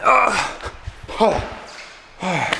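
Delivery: strained high groans of relief; exhausted groans. Real, not acted. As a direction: groan as the workout ends. Finishing long bike workout.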